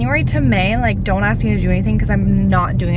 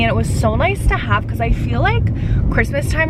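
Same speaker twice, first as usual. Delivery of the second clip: ordinary talk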